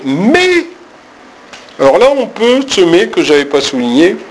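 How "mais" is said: mais jumps way up; stressed mais. Shown as a demonstration. Teacher marking the turn with a high mais. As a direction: throw the mais high as a hinge. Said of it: "le mettre très en relief".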